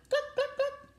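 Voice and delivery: very high clucks; the hens chasing the farmer. A narrator's reading. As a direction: cackle like hens.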